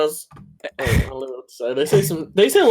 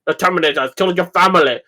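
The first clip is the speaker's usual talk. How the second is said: put-on fast, rising German-accented challenge; 5 dB louder than usual. Friend retelling the shotgun scene in a mock German accent. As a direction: bark it like a German drill sergeant. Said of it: "gave her a German accent".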